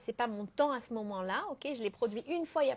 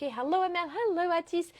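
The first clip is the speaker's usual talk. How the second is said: excited greeting; 5 semitones higher, 4 dB louder than usual. Real, not acted. Greeting viewers joining the live.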